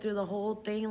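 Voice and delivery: ordinary delivery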